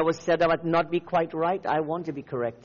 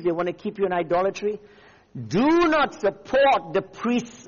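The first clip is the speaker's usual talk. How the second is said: raised, emphatic exhortation with stretched key phrases; forceful preaching; 5 semitones higher, 4 dB louder than usual. Real, not acted. Preacher warning believers not to support false priests, forceful. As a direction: charge the congregation, hammering each command.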